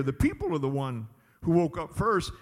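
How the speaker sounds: ordinary delivery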